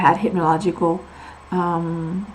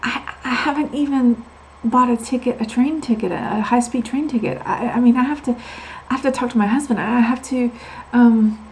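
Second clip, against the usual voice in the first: flustered panic; 5 semitones higher, 4 dB louder than usual. Retold or imitated. Her panicked reply to the doctor.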